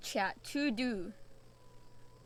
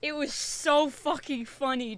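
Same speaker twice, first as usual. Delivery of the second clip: normal talking voice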